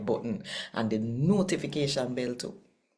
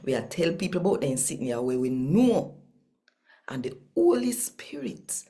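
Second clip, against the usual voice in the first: steady, even Bible reading in Patois; scripture reading; 4 semitones higher than usual. Acted. Host reading Acts to listeners, measured scripture narration. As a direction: read the passage as a daily devotion. Daily Jamaican New Testament reading.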